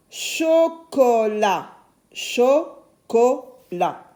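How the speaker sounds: cho, co, lat, with a gap after each; syllable by syllable. Shown as a demonstration. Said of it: "Nous avons deux O dans chocolat". (chocolat découpé lentement en syllabes)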